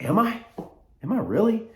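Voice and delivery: high-pitched, doubting questions; mock-puzzled, voice jumping high; incredulous reply. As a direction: squeak it upward as if you can't believe it. Retelling his surprised reply to compliment.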